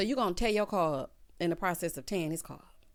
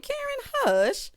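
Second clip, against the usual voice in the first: very high, louder mock reprimand; exasperated scolding; 5 dB louder, slower than usual. Host shushing Karen over bad advice, exasperated. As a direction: shoot up high to shush her.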